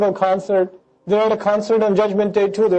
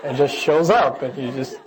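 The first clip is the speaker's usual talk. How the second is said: laughing through; 4 semitones lower, 3 dB quieter than usual. Real, not acted. Joke about big screen TV.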